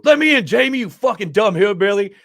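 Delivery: high, loud mock yell; yelling through door. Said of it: "Doc's knocking on the fucking door".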